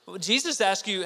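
ordinary delivery

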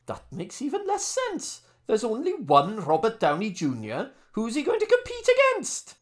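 put-on Welsh accent. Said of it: "maybe you could do that in a Welsh accent".

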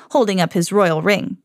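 ordinary delivery, nothing marked

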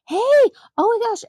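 high squealing hey, oh my gosh; imitating daughter's high alarmed exclamation; startled child's cry. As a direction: squeal it like a spooked kid.

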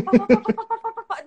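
fast loud pá pá pá, mock babble; machine-gun the words into her ear; rapid-fire chatter. Babbling nonstop into the teacher's ear, fast mock chatter. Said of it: "eu disparei de falar no ouvido dela".

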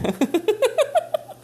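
high squealing laugh; burst of laughter. Real, not acted. Recalling stealing the cousin's sweets, high squealing laughter. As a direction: crack up mid-story. Laughing at her own prank.

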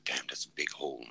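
hushed, breathless whisper to himself; whispered aside. Retold or imitated. Soldier recalling the wound, whispering to himself. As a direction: murmur it under your breath in disbelief.

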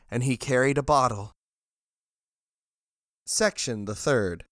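ordinary delivery

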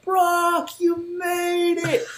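excited greeting. Retold or imitated. Friends welcoming Brock into the party, drawn-out high cheer. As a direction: sing out his name in delight. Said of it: "We are excited".

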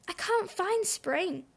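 high, soft, slow plaint; a little bunny's helpless complaint; plaintive complaint. A narrator's reading. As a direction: lament it softly, stretched out.

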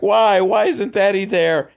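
put-on high, loud childlike wail; upset kids. Kids see the boat return without Daddy, wailing. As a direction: cry out like a scared child. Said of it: "they're like".

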